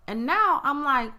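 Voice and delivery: usual speaking voice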